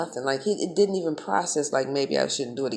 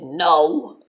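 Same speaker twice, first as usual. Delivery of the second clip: loud retold denial; 9 dB louder than usual. Retells his answer, punched loud.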